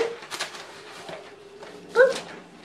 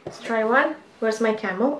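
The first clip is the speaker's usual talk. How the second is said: usual speaking voice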